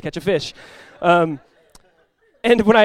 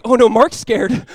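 slow, raised, louder dismay; drawn-out mock dread; retold alarm; 4 semitones higher, 6 dB louder, slower than usual. Realising the calmest man is scared, retold alarm. Alarm at calm friend's fear.

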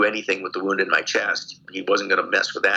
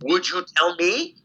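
higher, questioning appeal; retold earnest appeal; 8 semitones higher than usual. Prisoner turning the question on the lieutenant, retold earnest appeal. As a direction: put the question back to him, as retold. Retold reasoning with his interrogator.

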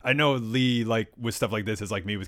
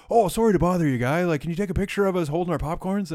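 higher-pitched mock Newfie accent; 6 semitones higher than usual. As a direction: imitate eager Newfoundland tourists, higher. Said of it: "that was their Newfie accent".

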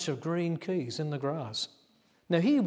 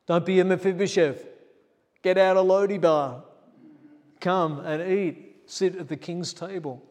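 louder, higher preaching climax; fiery preaching; 5 semitones higher, 10 dB louder than usual. Acted. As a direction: thunder the call to come and eat. Preaching at full force.

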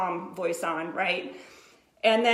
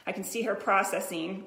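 ordinary talk, nothing marked

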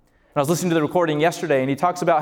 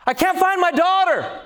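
high, desperate cry out; desperate yell; 10 semitones higher than usual. Retold or imitated. Father yelling at people about his missing daughter, desperate high yell. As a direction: imitate a father yelling in panic, pitch way up. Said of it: "he's yelling at people".

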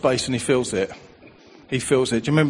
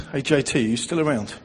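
normal talking voice

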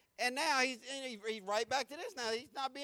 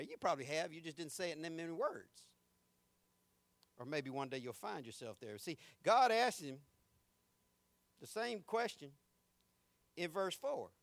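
ordinary delivery

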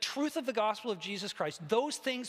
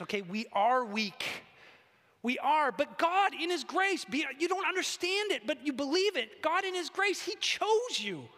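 higher, louder, building sermon voice; impassioned preaching; 8 semitones higher, 3 dB louder than usual. Acted. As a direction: preach it out to the congregation. Preaching at full force.

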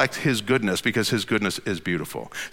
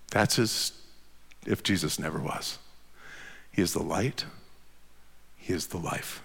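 low, soft voice with long pauses; slow down and sink toward a whisper; hushed reflection; 8 semitones lower, 11 dB quieter, slower than usual. Real, not acted. Preacher leading congregation with eyes closed, hushed reflection.

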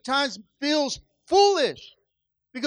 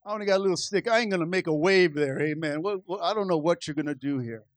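much lower, softer worried prayer; hushed anxious prayer; worried Moses; 8 semitones lower, 4 dB quieter, faster than usual. Acted. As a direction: murmur doubts quietly to the Lord. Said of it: "I said".

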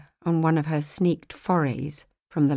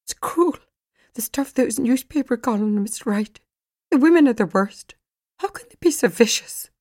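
higher and louder, distressed; upset outburst; 6 semitones higher, 5 dB louder than usual. A narrator's reading. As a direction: let the hurt spill over into a raised voice. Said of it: "Megan had sobbed on the phone".

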